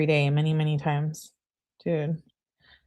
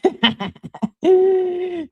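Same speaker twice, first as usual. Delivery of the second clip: laughing; 13 semitones higher, 4 dB louder than usual. Real, not acted. Laughs at comment.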